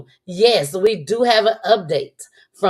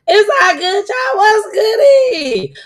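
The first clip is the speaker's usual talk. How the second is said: excited high greeting; 12 semitones higher, 4 dB louder, slower than usual. Real, not acted.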